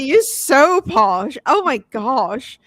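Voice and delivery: exaggerated retold teasing; teasing mock awe. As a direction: draw each word out in playful amazement. Imitates teasing him for being posh.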